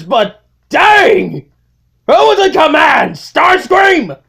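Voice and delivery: high, loud outraged yelling; outraged shout. Acted. Megatron returns to a ruined base, shouting outraged. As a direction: bellow in disbelief at the wreck.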